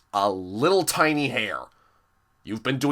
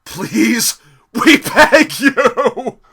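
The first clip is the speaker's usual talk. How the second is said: much higher and louder, slow pleading wail breaking into a laugh; mock desperate begging; 11 semitones higher, 10 dB louder, much slower than usual. Imitating fans begging for the old theme, hammed-up laughing wail. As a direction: ham up a desperate plea.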